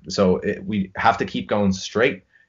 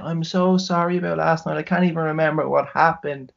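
put-on higher sheepish girly voice; apologetic text voiced; 9 semitones higher than usual. Hungover coworker apologizing next morning, higher sheepish voice. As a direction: read it like a contrite girl. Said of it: "a text the next morning saying".

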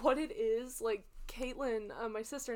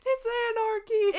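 higher, louder, laughing echo; gleeful mock panic; 10 semitones higher, 10 dB louder than usual. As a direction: shout it back with glee. Listener echoes panicked quote laughing.

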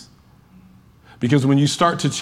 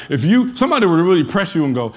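ordinary delivery

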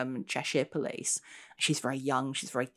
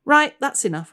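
high, loud, putting her foot down; fed up; 11 semitones higher, 10 dB louder than usual. Acted. Constable ending the chat with the ghost, loud and fed up. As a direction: cut the conversation off firmly. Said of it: "I said".

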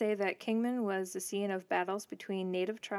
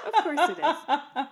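laughing; 8 semitones higher, 7 dB louder than usual. Real, not acted. Laughing at dad joke.

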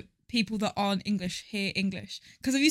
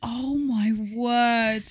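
drawn-out, very slow disbelief; real appalled amazement, slowly; stunned reaction; much slower than usual. Real, not acted. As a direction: stretch every word in shock. Reacting to a gruesome story.

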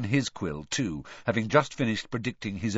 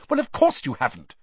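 brisk, higher-pitched dismissal; 5 semitones higher, 4 dB louder than usual. A narrator's reading. Hermione to Ron about her new society, briskly. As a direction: brush it off in a businesslike way. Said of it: "said Hermione briskly".

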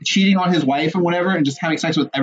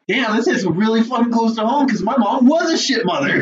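half-shouted outburst of recognition; exclaiming; 5 semitones higher than usual. Retold or imitated. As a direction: blurt it out, struck hard. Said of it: "I was like".

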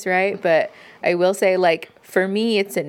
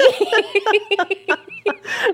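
a high, rising burst of real laughter; giggling fit, high and breathy; 13 semitones higher than usual. Real, not acted. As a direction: let the laugh rise high. Reacting to feet pics joke.

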